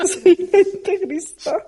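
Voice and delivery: laughing, owning it high and bright; giggling as she admits she's a fundamentalist; laughing admission. Real, not acted. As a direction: confess it through a laugh.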